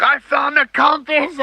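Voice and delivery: very high, slow, drawn-out boast; triumphant excited outburst. Retold or imitated. Diver boasting to his rival Joe about the find, triumphantly excited. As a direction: blurt out the big news, stretched and high-pitched.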